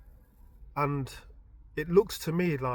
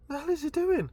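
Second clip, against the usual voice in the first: alarmed disbelief; 11 semitones higher than usual. Retold or imitated. Alarm at cousin in ring.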